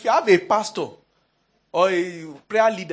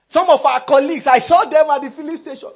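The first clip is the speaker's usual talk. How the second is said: loud, high public announcement; denouncing manager; 7 semitones higher, 10 dB louder than usual. Acted. Manager telling Monday meeting about staff he saw, loud denouncing voice. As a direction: proclaim it as a stern accusation.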